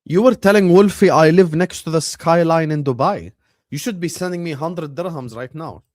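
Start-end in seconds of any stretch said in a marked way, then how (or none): 0.0-3.3 louder teasing accusation; loud accusing. Real, not acted. Streamer calling out a viewer, loud and accusing. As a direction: call him out loudly.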